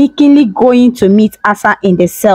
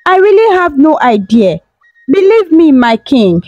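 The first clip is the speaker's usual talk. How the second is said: higher, tearful pleading; crying plea; 7 semitones higher than usual. Acted. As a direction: cry out your innocence, pitched high. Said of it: "she cried".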